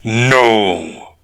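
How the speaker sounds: short snarled no through teeth; growled through clenched teeth. Acted. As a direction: growl it, jaw locked tight. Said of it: "Makoran growled between clenched teeth".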